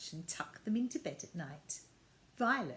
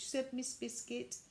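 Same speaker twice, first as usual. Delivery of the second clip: usual speaking voice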